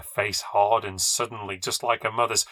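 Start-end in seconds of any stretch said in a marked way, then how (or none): none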